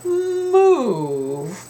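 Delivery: high, stretched move; stubborn cows held word; drawn-out move. Shown as a demonstration. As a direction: hold move long. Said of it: "That's the word I want you to help me with".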